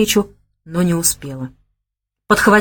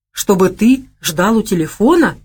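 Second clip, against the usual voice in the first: surprised. A narrator's reading. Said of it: "удивилась она".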